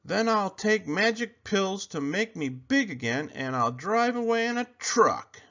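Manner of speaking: high bully voice, ranting. A narrator's reading. Bully Sammy ranting threats at Kevin. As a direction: pile threat on threat.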